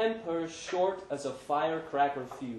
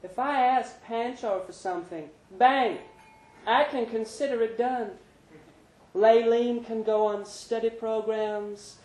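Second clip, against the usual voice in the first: Brenda's voice; 9 semitones higher, 4 dB louder than usual. A narrator's reading. Brenda confiding in Larry after the show. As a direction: coax him gently.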